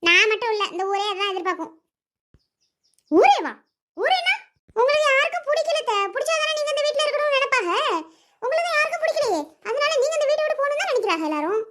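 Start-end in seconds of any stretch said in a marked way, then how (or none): 3.1-4.3 loud, shocked "ஊரே வா?" shot back; outraged echo. Acted. Aunt outraged by the insult. 4.8-11.6 high, cutting jibe at the aunt; taunting. Acted. Niece taunts the aunt during the quarrel.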